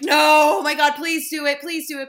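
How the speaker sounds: loud burst of no, then rapid repeated pleading; retold gushing plea, starting high and loud; excited begging. Retelling a text reply urging friend to do it, excited begging.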